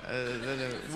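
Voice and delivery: ordinary talk, nothing marked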